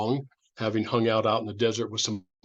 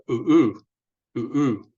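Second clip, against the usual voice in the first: hooting "ooh, ooh" like an owl, the first one louder; 5 dB louder than usual. Shown as a demonstration. Teacher imitating an owl hoot for the class. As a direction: hoot like an owl calling at night. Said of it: "from the sound he makes".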